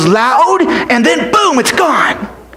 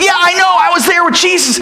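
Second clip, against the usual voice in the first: put-on higher, boyish reply; friend Benji; 8 semitones higher than usual. Friend Benji telling boy, higher. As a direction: answer as the eager friend.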